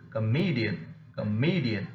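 light "co", strong "me" in "comedian"; stress on ME. Shown as a demonstration. Teacher shows second-last rule, comedian stressed on ME. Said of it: "me happens to be the second".

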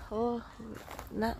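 usual speaking voice

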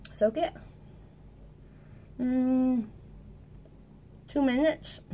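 words muffled round a reed in the mouth. Real, not acted. Teacher soaking the reed in her mouth, muffled. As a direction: talk with the reed held in the lips.

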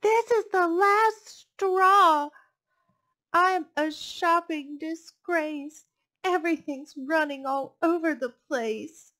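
high, loud, slow exasperated cry of a frazzled lady; exasperated shopper. Acted. The overwhelmed shopper despairs to herself, loud high exasperation. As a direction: wail it like you've had enough.

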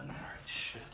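nervous whispered curse; whispering. Real, not acted. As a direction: breathe it out under the breath. Bracing for the hot wing.

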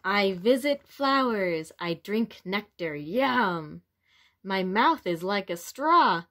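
lively, expressive picture-book read-aloud; reading to children. A narrator's reading. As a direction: read it to little ones with wonder.

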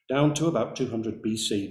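usual speaking voice

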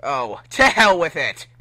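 loud fed-up shout; frustrated outburst. Acted. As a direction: throw your hands up and yell. Sonic gives up on collar.

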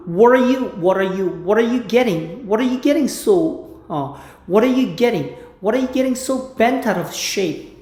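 slow, word-by-word English drill; slow line drill. Shown as a demonstration. Teacher repeats a Friends line slowly for practice. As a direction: chop the line into slow pieces. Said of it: "drilling line slowly".

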